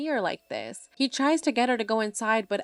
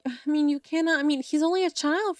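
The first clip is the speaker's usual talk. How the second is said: mildly indignant, pitched up; exasperated protest; 7 semitones higher, 4 dB louder than usual. A narrator's reading. Bjorn confronts Patrick over his crying son, exasperated protest. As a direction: protest in a higher, incredulous voice.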